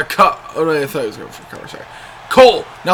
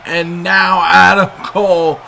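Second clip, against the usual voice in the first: hyped call; 4 dB louder, slower than usual. Real, not acted. Big spot called live.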